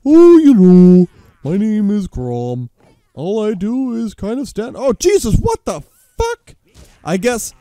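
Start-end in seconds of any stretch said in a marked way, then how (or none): 0.1-4.8 mock voice for an opponent who just stands; mocking dim fighter. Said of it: "this dude's just standing around like". 4.8-6.6 high-pitched shock; high, startled outcry; startled yelp. Real, not acted. Startled mid-joke by the game.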